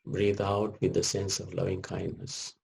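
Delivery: ordinary talk, nothing marked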